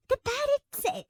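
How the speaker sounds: ordinary delivery, nothing marked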